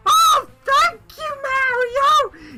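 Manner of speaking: shrieking falsetto squeal, high and loud; squeaky Toad voice. Pretend. Toad character squealing thanks to Mario in falsetto. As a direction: shriek it like a tiny grateful Toad. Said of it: "why do they both sound like this".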